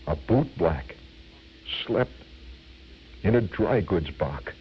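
low, slow stage recitation with long pauses; dramatic reciter. Retold or imitated. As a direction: intone it gravely, breaking after each phrase. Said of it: "in a very dramatic voice".

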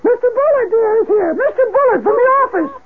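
very high, sing-song wife calling; wife's voice. Retold or imitated. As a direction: sweetly call up to the husband. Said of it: "his wife's voice says".

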